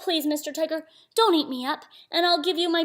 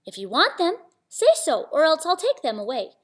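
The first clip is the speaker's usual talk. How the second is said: higher, raised calling voice; 5 semitones higher, 5 dB louder than usual. Acted. Little Samba warning tigers about their clothes, high calling voice. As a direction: call it up and out to them.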